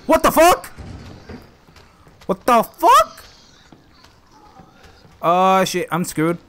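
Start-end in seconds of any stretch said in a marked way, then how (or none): 0.1-3.2 high loud shocked cry. Real, not acted. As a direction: shout in disbelief. Shock at enemy.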